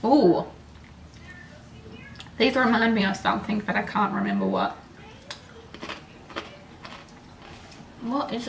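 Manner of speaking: chewing while talking; speaking between bites; mouth full of chip. Real, not acted. Seen 0: Bites chip repeatedly at 0.3 and 4.6, chews between words.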